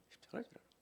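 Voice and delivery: 십자가를 whispered unvoiced. Real, not acted. Repeat whispered. (십자가를 속삭이듯)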